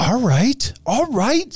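high, rising "all right", eager; perking right up with delight; excited. Real, not acted. Host gets excited about the most haunted bar, high and rising.

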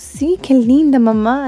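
put-on high sweet little boy voice; mother imitating her son agreeing, high and lilting; toddler voice. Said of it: "He goes".

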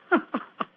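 a soft amused chuckle; a private laugh at a private joke; quiet chuckle. Acted. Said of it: "what are you laughing about?".

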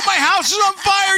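high, loud panicked yell; hammed-up desperate shout; frantic shout. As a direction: yell it out the car window. Shouting at cop from car.

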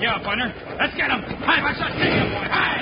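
high, loud yells to the horse; urging horse on. Acted. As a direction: whoop the horse into a gallop.

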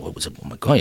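low, hushed mock murmur; mumbling to themselves. Lodge brothers react to the bad news, mumbling to themselves. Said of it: "everyone's kinda mumbling to themselves".

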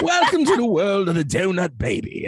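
high-pitched, hammed-up English announcement; deliberately bad English accent. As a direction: overdo a fake English accent. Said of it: "bad English accent on purpose".

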